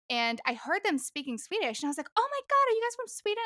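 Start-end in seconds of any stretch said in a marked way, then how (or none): 2.2-3.4 much higher, delighted squeal of recognition; thrilled to hear her own dialect abroad; excited surprise. Retold or imitated. Excited to meet Swedes at a museum, higher, delighted. Excited greeting to Swedish strangers.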